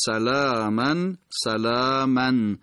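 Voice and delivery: سلامن twice, "salāāman, salāāman"; slow recited سلامن with long ā; paired repetition. Shown as a demonstration. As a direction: sound the -an ending on each سلامن. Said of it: "پشت سر هم، تکرارهای دوتایی".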